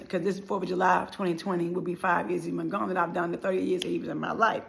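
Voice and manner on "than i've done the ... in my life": ordinary talk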